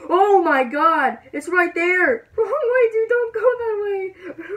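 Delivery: very high, loud, drawn-out panic; panicked yelling. Real, not acted. Gamer warning friend off the tornado, panicked yelling. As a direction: cry out in alarm, voice shooting way up. Tornado appears right there.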